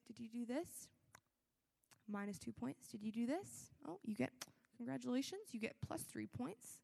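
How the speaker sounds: tally points like a dry examiner; mock examiner. Imagined judge with his paper marks behaviour, mock-official and quiet.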